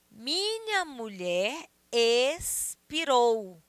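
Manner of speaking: "Minha mulher expirou" very drawn out, with gaps in "ex-pi-rou"; verse scanned slowly. Shown as a demonstration. Teacher reading a verse for scansion, "expirou" slowly split as es-pi-rou. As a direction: stretch each syllable of "Minha mulher expirou". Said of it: "Observa que expirou".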